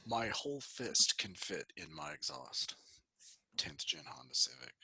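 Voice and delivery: low, slow voice fading to a near whisper; mock forum member. Joking as a Civic forum poster, low mock voice. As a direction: drop low and slow, trail into breath.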